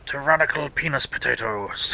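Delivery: slow, booming arrogant overlord; tyrannical overlord voice. Pretend. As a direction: lord it over everyone, slowly. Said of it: "Tyrannical overlord voice".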